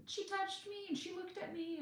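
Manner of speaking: imitating the kids' bickering in a high whine; whiny kid. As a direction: whine it like a child complaining about a sibling. Kids bickering in the car.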